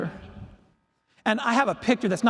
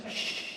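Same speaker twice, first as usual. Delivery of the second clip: long, soft "shh"; shushing; 13 dB quieter than usual. Retold or imitated. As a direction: silence them with one long hush. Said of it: "she shushed me".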